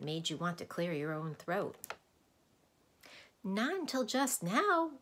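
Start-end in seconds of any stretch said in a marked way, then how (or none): 3.4-4.9 Milo's voice. Acted. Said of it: "replied Milo".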